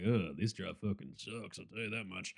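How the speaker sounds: a muttered, low disgusted gripe; mock grumble. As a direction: grumble it like a disgruntled fan. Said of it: "we're like".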